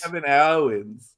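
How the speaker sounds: slow, overdone Australian vowels; amateur Australian accent. Pretend. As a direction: stretch it into a put-on Australian accent. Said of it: "more practice with the Australian accent".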